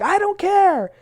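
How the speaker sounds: high, loud, careless brush-off; dismissive Meredith. Retold or imitated. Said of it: "Meredith's like".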